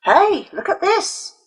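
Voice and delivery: put-on high, slow teasing boy voice; inviting bully. A narrator's reading. Bully showing off the stolen coins, high and teasing. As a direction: lure her in with a sly high call. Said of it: "he invited".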